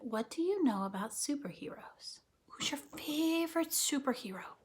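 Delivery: soft whispered question. Real, not acted. Teacher asking the class to think, soft whisper. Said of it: "teacher whispers to class".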